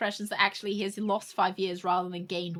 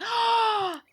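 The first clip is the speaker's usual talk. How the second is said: loud, high, theatrical gasp; shocked gasp; 12 semitones higher, 7 dB louder than usual. Acted. Streamer reacting to father's factory visits, theatrical gasp. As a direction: gasp in mock horror.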